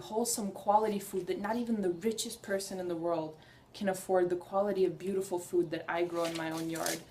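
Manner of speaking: ordinary talk, nothing marked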